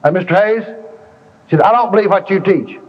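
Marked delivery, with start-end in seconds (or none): 0.0-0.6 higher-pitched woman calling on him; hint of a lady objector; imitated woman's voice. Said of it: "a woman's voice said". 1.6-2.8 high-pitched mimicked lady objector; retold challenge in a raised voice; imitated woman's voice. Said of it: "a woman's voice said".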